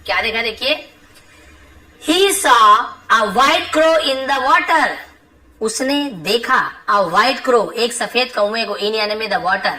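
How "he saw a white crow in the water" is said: slow dictation. Shown as a demonstration. Teacher reading the lesson sentence to students, slow and deliberate. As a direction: read it out slowly so the class can follow.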